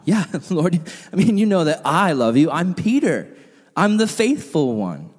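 "I" hammed up as a boast; mock-prideful Peter, big emphasis on "I"; stress on I. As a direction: lean hard on "I", proudly claiming to be the faithful one. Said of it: "Emphasis on the I".

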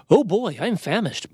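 high, drawn-out hungry eagerness; enthusiastic. A narrator's reading. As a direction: relish the thought of dinner. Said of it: "Bobo said enthusiastically".

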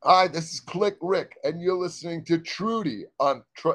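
radio announcer. Acted. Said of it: "another take of radio ID".

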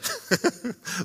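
a short, high, soft scoff of a laugh; high mocking laugh. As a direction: laugh in disbelief at the idea. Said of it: "She's laughing at".

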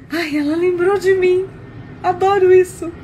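gush, touched at being remembered; delighted reaction. Retold or imitated. Boss reacting to a secretary's little sweet, delighted.

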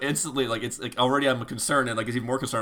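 ordinary talk

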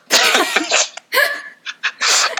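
breathy high laugh; laughing at the prank punchline. Real, not acted.

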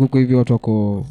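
ordinary delivery

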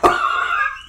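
shrieking laugh; high burst of laughter. Real, not acted. Laughing at choking cohost.

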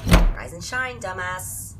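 high, loud teasing wake-up; loud wake-up call. Acted. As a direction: yell it to wake them. Waking roommate loudly.

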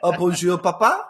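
hammed-up French accent, lilting upward. Kids greeting papa after picking up French, mock French accent.

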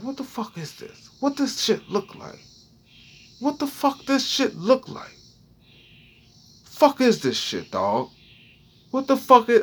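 high, hushed alarmed questions; fretting under his breath; high panicked muttering. Retold or imitated. A man inspecting his rash, hushed and high in alarm.

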